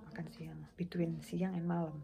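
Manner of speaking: whispered "makan siang" aside; whispering. Real, not acted. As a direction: whisper the joke to yourself.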